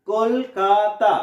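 কল-কা-তা, slow; three slow, distinct syllables on কলকাতা. Shown as a demonstration. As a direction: stretch কলকাতা into three clear beats. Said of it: "কয়টা উচ্চারণ করছি".

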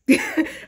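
short bright burst of laughter; a high laugh at the joke. Real, not acted. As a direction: break into a real laugh. Laughing at Leo confidence.

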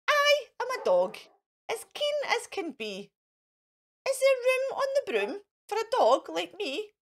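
high, keen dog asking; eager dog voice. A narrator's reading. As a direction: beg eagerly to come along. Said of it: "eagerly said".

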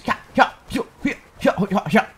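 a gleeful mock evil laugh at the party; mock villain laugh. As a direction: laugh like a cartoon troublemaker. Vandalizing the party, mocking laugh.

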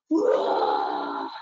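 low hammed-up roar; lion roar. Shown as a demonstration. Leader showing toddlers a roar, low mock roar. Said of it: "Can we do it again?".

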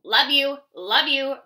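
loud mock croak; croaking brother. A narrator's reading. Toad brother croaking to little toads, loud croak. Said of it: "Brother croaked".

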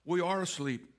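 little child's voice, gently imitated; lightly voiced small girl; Anna. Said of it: "Anna's little voice".

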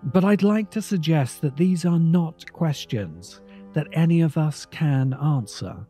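usual speaking voice

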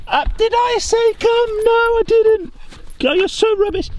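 squeaky playful voice, scolding the dog; high teasing voice to dog. Pretend. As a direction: chide the dog like a baby, high and sing-song.

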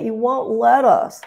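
high, slow whiny mock-child voice; whining child. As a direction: drag it out in a sulky whine. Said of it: "he sounded like a whining child".

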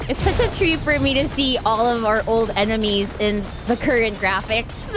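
ordinary talk, nothing marked

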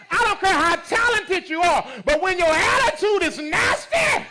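loud, high preacher exhortation; preaching hard. Acted. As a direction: hammer the warning at them. Preaching at full force.

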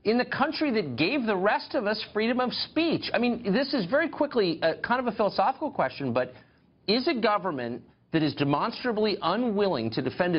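high-pitched, incredulous outrage with wide swings; indignant disbelief. Real, not acted. Host challenging the government over policing tweets, indignant and incredulous. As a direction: pitch leaping up as you demand an answer.

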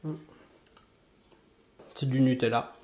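talking between bites; chewing while talking. Real, not acted. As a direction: mouth partly full. Seen 0: Bites the spread-covered bread near 1s, then chews while talking.

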